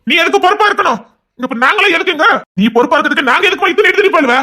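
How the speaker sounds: high, loud exasperated pleading; husband loudly protesting his crying wife's self-blame; heated protest. Acted. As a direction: insist hard that she needn't be responsible.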